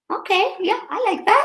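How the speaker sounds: very high, sing-song fake delight; mock delighted. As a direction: squeal it like a thrilled girlfriend. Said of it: "I was like".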